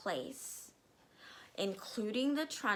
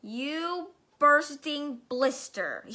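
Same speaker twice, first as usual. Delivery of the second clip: slow, loud, higher insult; furious yell; 6 semitones higher, 7 dB louder, slower than usual. Acted. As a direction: spit the insult out at the boy. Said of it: "yelled the Trunchbull".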